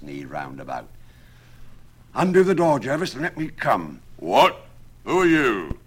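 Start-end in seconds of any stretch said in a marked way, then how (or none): 2.1-4.0 high, loud call at a door. A narrator's reading. Absalom calls to the smith through the door, loud. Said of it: "calling at smith's door". 4.2-5.8 louder surprised challenge; gruff-ish surprised call. A narrator's reading. Smith calls back at the knock, loud and surprised. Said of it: "smith answers".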